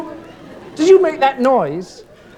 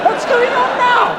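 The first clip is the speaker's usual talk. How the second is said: much higher and slow; baffled; 11 semitones higher than usual. Acted. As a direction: stretch it out, totally bewildered. Guest makes another strange noise.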